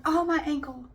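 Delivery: whiny attention seeker. Retold or imitated. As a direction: ham up the injured drama queen, fishing for sympathy. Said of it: "they just kept going".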